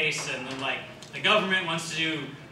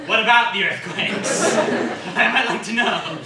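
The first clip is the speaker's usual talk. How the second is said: louder, laughing through the quote; laughing mock question; 4 semitones higher, 6 dB louder than usual. Pestering the translator about the earthquake slide, laughing. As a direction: ask it while cracking up.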